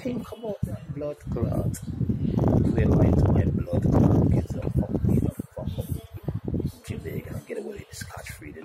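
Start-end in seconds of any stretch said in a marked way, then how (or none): 0.0-5.9 angry shouting. Real, not acted. Man venting about lies told to his kids, shouting. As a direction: bellow it, betrayed and furious.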